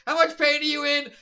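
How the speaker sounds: high, loud hammed-up question; mock hero asking. Retelling the comic scene, hammed-up worried question. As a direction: play the worried hero for laughs.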